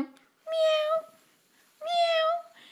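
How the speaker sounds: very high, drawn-out meow like a kitten; squeaky kitten cry, way up high; imitated meowing. Luring the kitten back through the woods with very high meows. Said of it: "So I start going".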